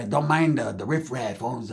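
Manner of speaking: ordinary delivery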